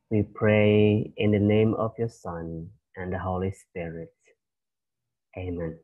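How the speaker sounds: teacher leading the class in prayer. Acted. Opening prayer before lesson.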